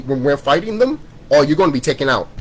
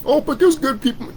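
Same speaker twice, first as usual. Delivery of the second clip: very high-pitched mimicry of an objector; mocking objection; 12 semitones higher than usual. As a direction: pitch it way up to mock the protest.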